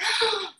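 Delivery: high sharp gasp; new ambassador gasping; mock shocked gasp. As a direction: gasp in pretend outrage. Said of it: "you're like".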